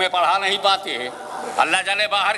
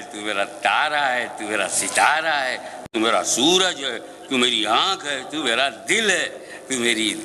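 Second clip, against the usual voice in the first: soothing lullaby talk; 4 semitones lower than usual. Acted. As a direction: hush the crying son. Father soothing crying baby. (रोते बच्चे को धीमी, नरम आवाज़ में बहलाना)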